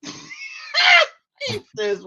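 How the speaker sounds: breaking into high laughter mid-line; cracking up, can't get it out; laughing. Real, not acted. Retelling the movie's peas line: real laughter. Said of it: "I'm trying to compose myself".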